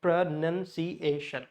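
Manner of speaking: slow "Pronunciation" in five separate syllables. Shown as a demonstration. Teacher showing it has five syllables, slowly separated. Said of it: "it has five syllable".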